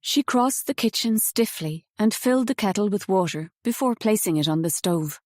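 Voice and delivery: ordinary delivery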